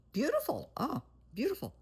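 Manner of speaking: mock praise. Real, not acted. Mocking son's 2am opera singing.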